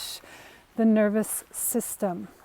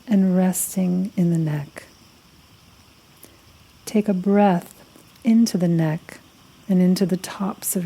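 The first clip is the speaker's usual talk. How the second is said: guided meditation; 4 semitones lower than usual. Acted. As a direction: lead them unhurriedly through a body scan, phrase by phrase. Leads a guided body-scan meditation.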